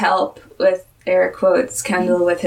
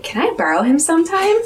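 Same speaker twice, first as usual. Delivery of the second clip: hopeful retold request; 8 semitones higher than usual. Retells Katie asking to borrow him.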